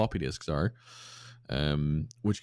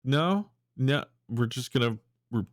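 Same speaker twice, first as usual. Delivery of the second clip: higher, hammed-up jittery guard voice; nervous guards; 6 semitones higher than usual. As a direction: play a guard who heard something and wants to ignore it. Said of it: "guards standing outside kind of go".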